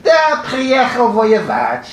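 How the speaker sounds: put-on high, slow Yiddish-accented answer; imitated Yiddish accent, pitched high and stretched out; mock Yiddish accent. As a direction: answer back in an exaggerated, singsong Yiddish accent. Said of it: "in the same mock Yiddish accent".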